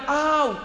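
high yelped ouch, hammed up; a sharp pained yelp, acted; mock ouch cry. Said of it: "Los dos gritaron". (grito de dolor fingido, agudo)

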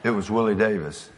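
ordinary talk, nothing marked

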